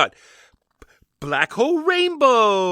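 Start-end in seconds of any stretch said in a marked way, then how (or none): 1.2-2.7 high, slow kids' cartoon announcer; exaggerated cartoon intonation. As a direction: announce it like Saturday morning TV. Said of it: "You could do it like a different voice".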